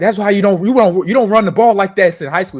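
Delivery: loud, high-pitched imitation of a teammate; retold taunt. Lineman scolding freshman ball carrier, loud retold taunt. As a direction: bellow at a rookie, imitating the lineman.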